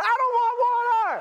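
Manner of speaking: hammed-up high angry yell; disproportionate outrage. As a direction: refuse in a mock tantrum, shrieking it. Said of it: "in a disproportionate kind of a way".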